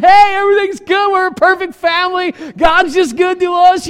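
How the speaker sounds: bright, loud, high put-on happiness; fake cheery churchgoer. Family greeting church greeters, hammed-up fake cheer.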